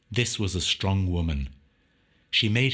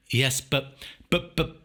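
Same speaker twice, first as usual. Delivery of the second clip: rising higher on each broken but; stammering; 6 semitones higher than usual. A narrator's reading. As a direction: stumble over the buts in shock. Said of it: "he stammered".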